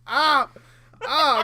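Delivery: sudden high, loud "ah"; sharp reenacted cry of pain; loud yelp of pain. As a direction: yelp as if just bitten. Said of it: "like, a real loud".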